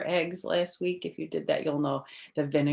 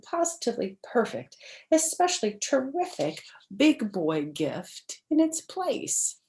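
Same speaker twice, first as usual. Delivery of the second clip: bright, lively storybook read-aloud; reading to children; 6 semitones higher, 8 dB louder than usual. Acted. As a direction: read it like story time for little kids. Reads picture book to children.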